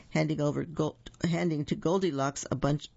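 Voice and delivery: ordinary delivery, nothing marked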